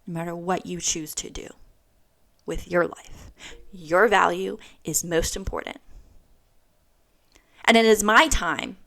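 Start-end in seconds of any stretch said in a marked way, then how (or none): 0.0-5.8 soft whisper, lower and softer. Real, not acted. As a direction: lean in and whisper it close. Intimate whispered affirmation.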